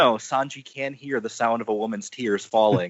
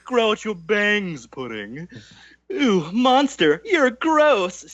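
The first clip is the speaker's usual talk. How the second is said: high, cackling, mocking matriarch; Big Mom voice; 7 semitones higher, 3 dB louder, slower than usual. Big Mom mocking Pudding, high and laughing. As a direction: sneer at her daughter with a laugh. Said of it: "[name] found our Big Mom voice".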